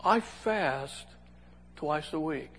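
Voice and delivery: mock-pious quiet boast; self-satisfied prayer tone. Pharisee tells God of his fasting, mock self-satisfied. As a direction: softly savour each phrase, with pauses. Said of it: "he said that in that tone to God".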